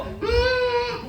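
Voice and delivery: a tiny, very high crying hum; mock strained whimper. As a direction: strain like a woman in labor. Mother straining in labor. (rintihan ibu melahirkan, tinggi)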